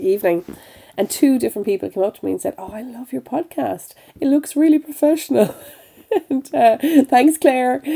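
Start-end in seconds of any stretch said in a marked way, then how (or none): 2.6-5.5 quoting praise. Retold or imitated. Retold praise from event-goers. 5.6-7.8 laughing. Real, not acted. Laughing thanks.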